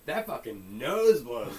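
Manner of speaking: loud, slow catchphrase; loud slow drawl. Acted. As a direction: drag it out loudly. Building up the catchphrase.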